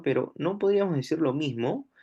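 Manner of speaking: normal talking voice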